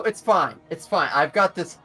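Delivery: normal talking voice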